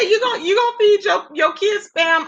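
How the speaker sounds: mock-shouted question; retold outraged cry. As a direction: yell it the way she did. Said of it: "when she said".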